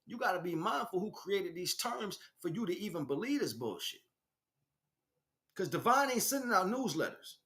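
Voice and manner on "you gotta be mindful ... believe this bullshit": usual speaking voice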